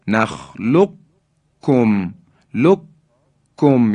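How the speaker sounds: نخلقکم as nakhlukkum, holding the double k; slow, broken-down demo of لککم with a firm doubled k; merged, firm kaf. Shown as a demonstration. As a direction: hold the closure in لککم, release hard.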